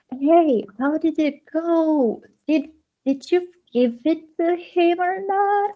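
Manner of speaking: high, drawn-out pleading check-in; anxious retold question. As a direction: pitch up high and ask nervously. Anxiously asking partner about TV gift.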